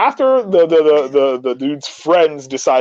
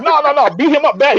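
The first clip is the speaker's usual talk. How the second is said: egging-on taxi driver; 10 semitones higher than usual. Retold or imitated. Taxi driver eggs on the fight.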